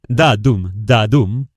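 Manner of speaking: "da-dum" with the beat on "dum"; iambic da-dum. Shown as a demonstration. Showing writers the iambic meter, a spoken da-dum beat. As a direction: tap out an iambic beat, light "da", strong "dum". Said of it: "Si la mayoría de las líneas son yámbicas".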